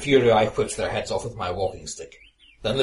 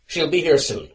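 angry; 9 semitones higher, 8 dB louder than usual. Acted. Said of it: "I snarled".

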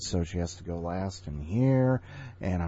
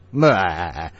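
one more blah; 6 dB louder than usual. Real, not acted. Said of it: "now I can't stop".

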